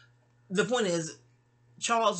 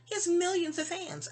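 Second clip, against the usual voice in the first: high-pitched imitation of sister; quoting sister; 11 semitones higher than usual. Sister turning down advice about [name], high-pitched protest. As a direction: quote her, pitched well above your own voice. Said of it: "She was like".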